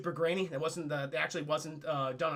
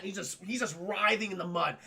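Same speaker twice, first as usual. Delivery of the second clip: ordinary talk, nothing marked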